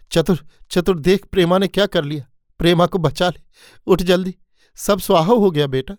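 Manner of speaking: mother waking her son in panic, tearful and high; crying in panic. Acted. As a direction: wake him desperately, frightened. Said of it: "माँ रो रही थी".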